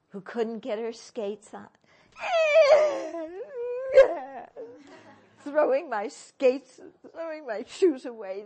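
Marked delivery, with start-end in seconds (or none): 2.1-5.1 loud squealing laugh-cry; high wailing laugh. Real, not acted. Remembering her childhood tantrum. 5.4-8.4 louder, still half laughing; laughing retelling. Retold or imitated. Retelling her childhood tantrum.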